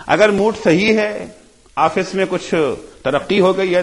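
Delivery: ordinary talk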